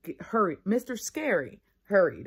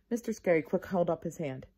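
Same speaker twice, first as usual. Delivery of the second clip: plain reading voice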